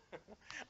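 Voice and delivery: short real laugh at the folly. Real, not acted. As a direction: laugh before catching yourself. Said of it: "I shouldn't laugh".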